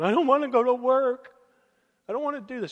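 put-on high-pitched whining teenage wail; hammed-up tearful protest, loud at first; mock crying. Teenager pleading with his mom at three thirty, mock crying. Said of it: "looking at her, and I'm crying".

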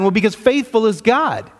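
normal talking voice

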